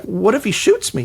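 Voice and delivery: high, worried squeak; mock fear. Writer fearing a gunman, mock nervous. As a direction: ask it in a nervous panic.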